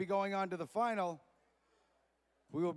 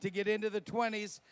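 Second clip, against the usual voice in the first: booming event announcer introductions; 3 semitones higher, 5 dB louder than usual. Acted. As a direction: sell each name to the crowd. Commentary during race.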